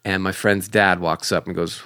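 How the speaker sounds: ordinary delivery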